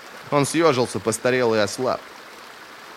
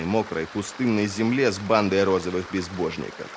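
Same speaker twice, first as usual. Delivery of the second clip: usual speaking voice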